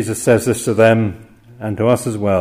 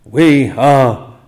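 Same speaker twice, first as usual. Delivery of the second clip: louder, pleading cry, the last words drawn out slowly; desperate cry for help; 4 dB louder, much slower than usual. Acted. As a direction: call out to be saved from drowning. Disciples crying out in the storm.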